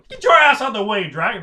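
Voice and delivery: loud, high burst of impatience; rude shout. Acted. Passer-by yelling at a dragon in the way, rude shout. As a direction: shove them aside with a shouted order.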